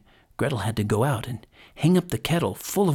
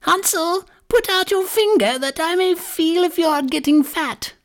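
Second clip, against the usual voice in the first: put-on high-pitched old crone cry; witch calling out; 3 dB louder than usual. A narrator's reading. Old woman cries to Hansel at the stable, crone voice. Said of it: "hobbled out to the stable and cried".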